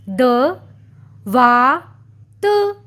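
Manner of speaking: द short, वा drawn long, त short; syllable by syllable. Shown as a demonstration. Teacher sounding out दवात. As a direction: break दवात into द, वा, त. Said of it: "व को लंबा उच्चारण".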